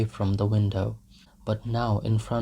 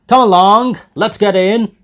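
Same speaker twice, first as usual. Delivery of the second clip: calling out; 11 semitones higher, 14 dB louder than usual. Acted. Drunk peasants calling out.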